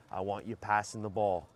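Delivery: usual speaking voice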